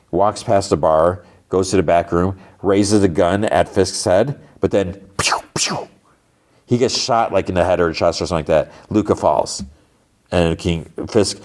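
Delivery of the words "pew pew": quick mouthed "pew" shots; gunshot noises. Retold or imitated. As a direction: imitate silenced gunfire with your mouth. Imitating silenced gunshots.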